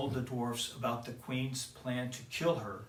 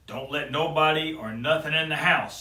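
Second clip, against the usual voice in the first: louder gruff dwarf warning; 3 semitones higher, 7 dB louder than usual. Acted. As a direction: order her sternly. Said Grumpy.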